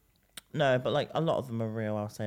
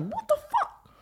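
shocked; 6 dB louder, slower than usual. Retold or imitated. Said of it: "I was like".

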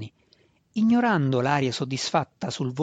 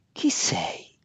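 ghostly spirit voice; 4 semitones higher than usual. Acted. Spirit of dead mother speaks.

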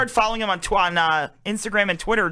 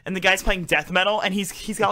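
ordinary talk

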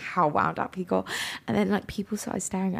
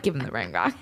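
much lower and softer; a lightly voiced low joking retort; deep mock voice; 10 semitones lower, 5 dB quieter than usual. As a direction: drop low for a deadpan joke. Joking retort in retold scene.

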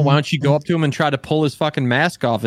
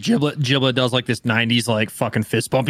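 ordinary talk

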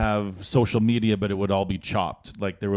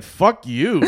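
mock angry retort; 9 semitones higher, 6 dB louder than usual. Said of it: "You'd be like".

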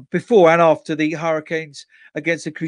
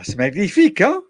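French; 4 semitones higher than usual. Acted. Speaking French playfully.

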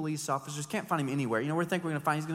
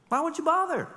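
high, louder scoffing question; mock incredulity; 13 semitones higher, 5 dB louder than usual. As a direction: scoff at the hard road.

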